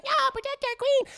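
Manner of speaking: very high tiny minion voice; a loyal tiny dandelion crying out; squeaky minion. Retold or imitated. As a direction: squeak it like a little plant soldier. Dandelion minions defend the queen.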